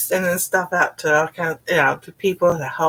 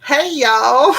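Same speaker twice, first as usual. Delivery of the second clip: put-on laughing hey y'all in a Southern accent; a Southern greeting through a laugh; Southern twang with a giggle; 8 semitones higher, 5 dB louder than usual. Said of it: "'Cause I had that southern accent".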